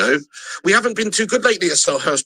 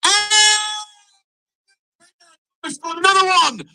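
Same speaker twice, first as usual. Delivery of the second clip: excited commentary; 8 semitones higher than usual. Acted. Fan calling his own match live.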